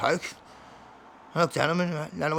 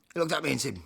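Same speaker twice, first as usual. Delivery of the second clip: ordinary talk, nothing marked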